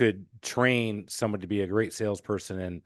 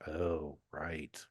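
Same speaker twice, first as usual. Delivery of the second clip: quiet realization; 6 semitones lower, 12 dB quieter than usual. Retold or imitated. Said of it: "I'm like".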